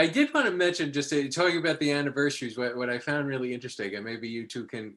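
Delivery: ordinary talk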